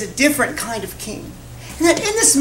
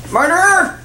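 higher, projected call to a crowd; calling out choice; 8 semitones higher, 4 dB louder than usual. Acted. Pilate offers the crowd Barabbas, calling out. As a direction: put the first option to the mob.

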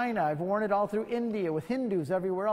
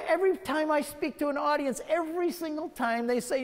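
ordinary talk

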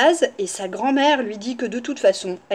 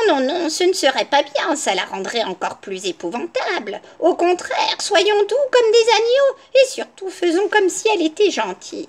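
lightly voiced granny, pitched up in places; scheming grandmother; 6 semitones higher than usual. A narrator's reading. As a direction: coax the wolf into your plan. Grandmother counsels the wolf.

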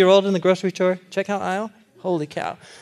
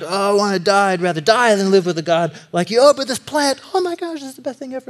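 higher, sulky turning giddy over the plant; moody Jonah; 4 semitones higher than usual. Retold or imitated. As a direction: gush over the plant like a toddler. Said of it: "'cause he's like".

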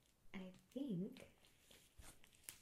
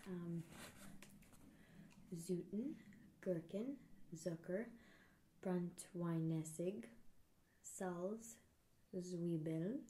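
German reading; slower than usual. Shown as a demonstration. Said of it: "reading German ingredients aloud".

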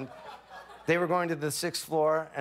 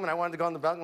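ordinary talk

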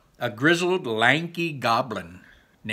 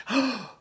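sharp high gasp of delight; 13 semitones higher than usual. Acted. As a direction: gasp in surprise. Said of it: "The Manticore gasped".

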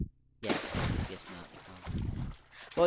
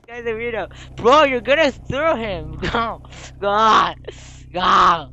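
high, very loud shouting at teammates; loud, high-pitched game panic; urgent yelling; 8 semitones higher, 17 dB louder than usual. Real, not acted. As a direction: yell them on in alarm.

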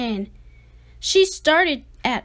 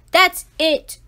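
loud final word; fed-up dad; 3 semitones higher, 8 dB louder than usual. Acted. Dad fed up with Ada, a loud exasperated line. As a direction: close the matter. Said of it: "said her dad".

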